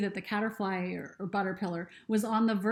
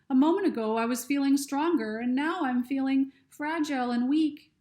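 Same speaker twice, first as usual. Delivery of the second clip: a lightly voiced young girl, higher, worried; higher girl voice, anxious and unsure; Lucy's voice; 5 semitones higher, 5 dB louder than usual. Lucy speaks in story.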